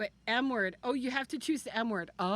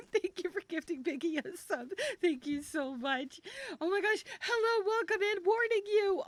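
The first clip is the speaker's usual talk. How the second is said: excited thanks; 9 semitones higher than usual. Real, not acted. Thanking gifters, greeting newcomers excitedly.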